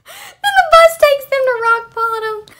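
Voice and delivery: loud, high booming reveal; hammed-up dramatic boom; dramatic announcement. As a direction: belt it like a cartoon narrator. Retelling SpongeBob, dramatic reveal.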